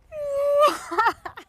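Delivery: loud high-pitched mock wail; baby-like crying wail. Storyteller showing how she cried, mock baby wail. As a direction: imitate a bawling baby at full volume. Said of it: "real crying, like a baby".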